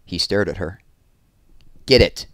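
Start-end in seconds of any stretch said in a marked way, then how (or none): 1.9-2.3 curt, loud command; ordering. A narrator's reading. Warden to her staff member, ordering. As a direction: give a sharp order, raised in pitch. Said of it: "she ordered".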